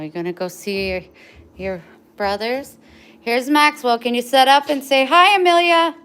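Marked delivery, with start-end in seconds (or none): 3.2-5.9 high, loud, bright call to the dog; loud high-pitched pet voice; excited dog talk. Real, not acted. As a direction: hype the dog up to say hi.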